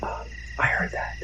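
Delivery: tense low whisper. Acted. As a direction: whisper it so nothing hears you. Whispered night scare skit.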